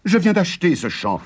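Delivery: high-pitched, indignant objection; indignant protest. A narrator's reading. Farmer protests that he just bought the field, indignant. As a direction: protest with rising agitation.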